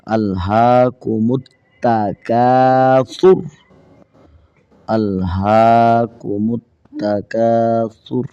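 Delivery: slow, stretched chanted verse; Quran recitation. Shown as a demonstration. Teacher recites the joined verse for students, slow and chanted. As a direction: draw every syllable out in tajweed style.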